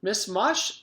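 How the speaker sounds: a cheerful lunch lady. A narrator's reading. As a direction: announce yourself through the door. Miss Mush voice.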